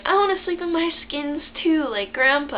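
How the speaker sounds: put-on high-pitched small child with an accent; little-kid voice. Little girl telling mom and grandma, high childlike voice. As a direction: pipe it up like a toddler. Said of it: "With that accent. That's exactly how I talked when I was little".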